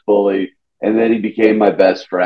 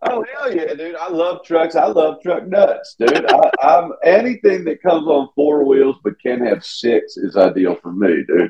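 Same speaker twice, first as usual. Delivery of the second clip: higher, hammed-up Southern truck lover; Southern accent; 6 semitones higher than usual. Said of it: "my Southern accent comes out".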